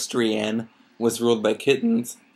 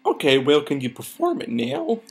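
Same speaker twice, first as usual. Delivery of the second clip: higher, lilting character question; pony character voice; 10 semitones higher than usual. Acted. Applejack asking Twilight to cast the spell, character voice. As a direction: swing the pitch up on the question. Reader voicing Applejack's dialogue.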